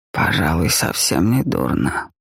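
put-on low, slow tired old man. A narrator's reading. Helmut voiced in the story.

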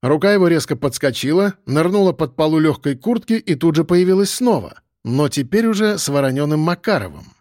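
ordinary delivery

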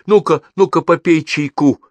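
insistent motherly call; exclaiming. A narrator's reading. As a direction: urge him brightly to drink up. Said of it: "воскликнула тётя Груша".

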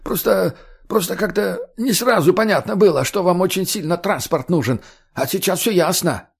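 high, stammering excuse; nervous hurried. A narrator's reading. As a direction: babble it out nervously. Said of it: "торопливо ответил Худой".

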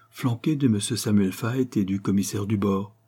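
usual speaking voice

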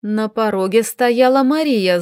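ordinary delivery

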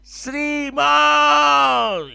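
high, loud shouted reply; big yell. Retold or imitated. Traveller yelling back at the British immigration officer, high loud shout. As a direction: shout so hard the officers jump. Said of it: "le pego un grito".

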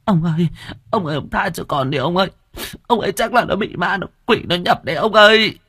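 put-on very high, hurried pleading old woman; wife clinging to her husband and begging. A narrator's reading. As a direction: plead that the boy is possessed. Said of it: "ôm chồng mà cầu xin".

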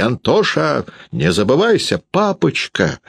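high-pitched reproachful cry; agitated high call in a family quarrel. A narrator's reading. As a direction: protest heatedly, voice leaping up. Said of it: "закричал Антоша".

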